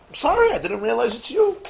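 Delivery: high, sheepish sorry; embarrassed apology. Retold or imitated. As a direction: apologise awkwardly, caught out. Accuser apologises again.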